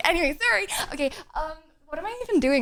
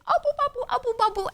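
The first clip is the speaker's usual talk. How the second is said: put-on much higher cooing baby talk to the dog; high-pitched baby voice; 11 semitones higher than usual. Shown as a demonstration. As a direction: coo it at the puppy like baby talk. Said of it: "anything in a high-pitched baby voice".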